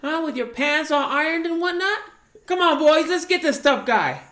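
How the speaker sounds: loud, high-pitched Boston heckling; Boston tough guy. Acted. Hired gang leader confronting a parking offender, Boston tough-guy shout. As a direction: pick a fight in a hammed-up Boston accent. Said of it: "those Boston tough guys".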